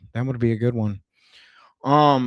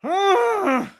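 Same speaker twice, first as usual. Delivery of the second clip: high, held cry of frustration; frustrated cry; 3 dB louder than usual. Acted. Streamer after his own outburst, high frustrated cry. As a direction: let out a high exasperated "ah".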